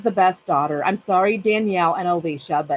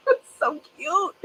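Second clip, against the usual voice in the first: high, slow, squealing "so cute"; squealed, drawn-out gush; 10 semitones higher, slower than usual. Real, not acted. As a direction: overcome with puppy love. Gushing about the puppy.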